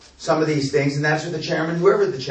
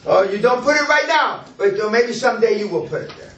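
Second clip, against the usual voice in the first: teasing; 8 semitones higher than usual. Real, not acted. Teasing trainee's answer.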